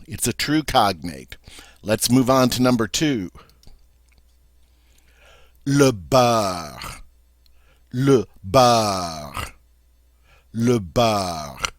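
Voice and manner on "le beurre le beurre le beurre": slow French "Le beurre", stress on the end; French pronunciation. Shown as a demonstration. Teacher repeating "Le beurre" for students to copy. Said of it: "the French way of saying".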